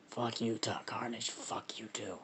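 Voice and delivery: quiet, husky monster retort; Venom. Acted. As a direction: answer back in a hushed creature voice. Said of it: "I gotta do my deep voice".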